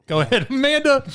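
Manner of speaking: high, laughing call to Amanda. Real, not acted. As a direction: laugh through the words.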